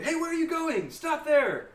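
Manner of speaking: high, loud guard's challenge; yelling. Acted. Game master's guard calls to fleeing player, yelling. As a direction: yell after someone walking off. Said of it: "he's gonna yell at you".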